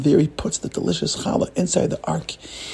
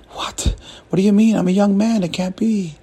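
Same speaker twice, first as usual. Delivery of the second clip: higher, disbelieving plea; alarmed protest; 5 semitones higher than usual. Acted. Rabbi hearing the angel's death sentence, alarmed protest. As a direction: plead against it in shocked disbelief.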